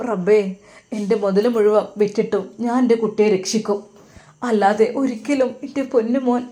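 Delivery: ordinary delivery, nothing marked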